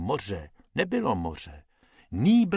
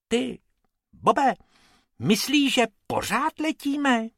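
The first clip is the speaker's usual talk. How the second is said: high, timid rabbit voice, asking; a small frightened bunny peeking out; scared little rabbit; 12 semitones higher than usual. Acted. Bob asks Bobek while flying, high and anxious.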